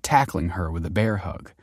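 plain reading voice

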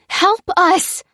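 high, loud bellow for help. A narrator's reading. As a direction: throw the call out at full voice. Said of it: "bellowed Lucy".

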